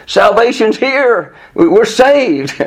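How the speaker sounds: high, slow, exultant cry; joyful praise. Retold or imitated. Crowd hailing Jesus as saviour, high and joyful. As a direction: announce rescue with delight. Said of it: "it was".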